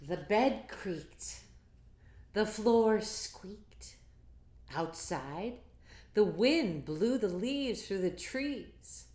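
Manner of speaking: put-on lively read-aloud to kids; picture book reading. A narrator's reading. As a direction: read it big and bouncy for children.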